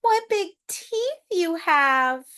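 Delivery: ordinary delivery, nothing marked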